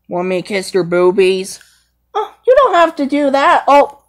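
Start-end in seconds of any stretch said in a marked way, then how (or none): 2.1-4.0 higher, quick, embarrassed Nala; flustered girl lion. Pretend. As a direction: blurt it out shyly.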